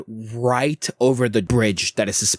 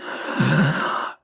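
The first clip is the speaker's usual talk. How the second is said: euphoric hag gasp; a greedy, delighted intake of breath; hungry gasp; 4 semitones higher than usual. Acted.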